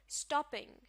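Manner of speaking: Stopping with the weight on स्टॉ; stopping stressed up front, falling at the end; stress on first syllable. Shown as a demonstration. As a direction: punch स्टॉ, let ping fall away. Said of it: "stress शुरू के हिस्से पर होगा".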